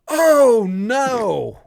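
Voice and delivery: sudden high, loud "Oh, no"; panicked yell. Retold or imitated. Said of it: "they just hear me go".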